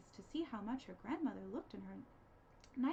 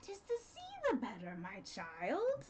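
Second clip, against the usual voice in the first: sly wolf; 4 dB louder, slower than usual. Acted. Wolf answering.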